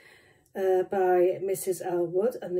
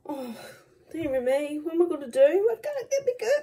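high whiny wail; 9 semitones higher than usual. Real, not acted. Can't open jar, wailing.

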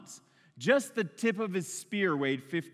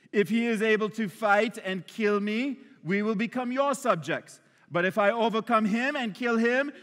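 raised, higher bad-guy Eastern European accent; hammed-up Eastern European warrior challenge; Goliath; 4 semitones higher, 4 dB louder than usual. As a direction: taunt the enemy ranks as an Eastern European villain. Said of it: "Eastern European accent".

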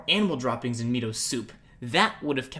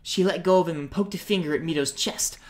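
ordinary delivery, nothing marked

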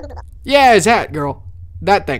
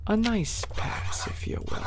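slow breathy whisper; 4 semitones higher, 8 dB quieter than usual. Acted. Guard slipping a pass to an entrant, whispered. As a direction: lean in and whisper it.

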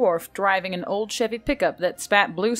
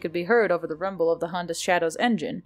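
ordinary delivery, nothing marked

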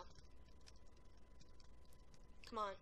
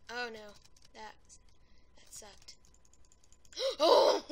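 usual speaking voice

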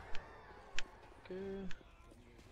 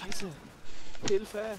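panicked yell; 7 semitones higher, 5 dB louder than usual. Real, not acted. Downed by zombies, calls help.

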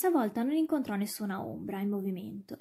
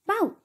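sudden high, loud spoken woof; narrator's sharp imitation bark; dog bark; 10 semitones higher, 6 dB louder than usual. A narrator's reading. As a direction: bark like a dog in one syllable. Narrator voices the dog's bark.

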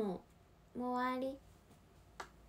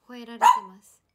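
raised voice; 11 dB louder, faster than usual. Real, not acted. Dog barking over her.

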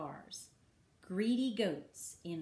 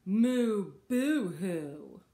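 loud mock boo-hoo; big storybook wail of self-pity; stewing cow; 7 dB louder, slower than usual. A narrator's reading. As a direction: moo it out like a sulking cow. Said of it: "One cow stews".

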